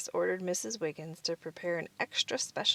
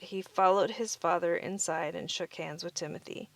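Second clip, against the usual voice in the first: plain reading voice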